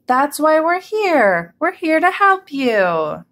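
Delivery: high, drawn-out declaration; crowd's cry. A narrator's reading. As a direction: call out slowly for a whole crowd. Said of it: "cried everybody".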